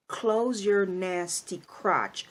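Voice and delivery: each word spaced out and stressed; slow scolding command; slow, stern command. Real, not acted. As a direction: lay down the law slowly, no rush. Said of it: "commands mothers".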